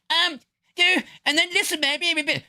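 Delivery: mock a rival's pathetic voice; mocking Chud. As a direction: squeak it out to belittle a rival. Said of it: "then Chud said".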